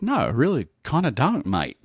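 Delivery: high mock-Aussie refusal; pleading, half-assed Australian voice; fake Australian accent. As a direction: decline in a put-on Australian accent. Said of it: "talking in my fake Australian accent".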